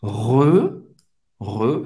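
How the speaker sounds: "Re" with French R and rounded EU; isolated syllable "Re", closed vowel. Shown as a demonstration. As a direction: say "Re" with an O mouth saying E.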